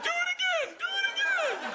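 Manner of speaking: very high-pitched, hysterical mock squeal; delighted shriek. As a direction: imitate a kid shrieking with glee.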